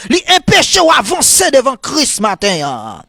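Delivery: high, fast-building revival sermon; full-force preaching. Real, not acted. Preacher warning the church about sin, full-force preaching. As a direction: drive each phrase high, then drop on the ending.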